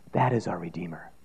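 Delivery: soft, hushed whisper. Real, not acted. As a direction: drop to a whisper for the key truth. Quiet climax of sermon.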